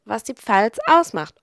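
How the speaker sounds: usual speaking voice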